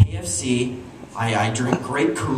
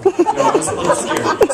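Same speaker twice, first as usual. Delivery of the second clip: high, louder, laughing through it; 4 dB louder than usual. Real, not acted. Comedian laughing about being scared, high and louder. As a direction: laugh as you admit it. Laughing while admitting fear.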